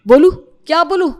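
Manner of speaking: frustrated outburst. Acted. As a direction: blow up at your mother. Frustrated son shouts.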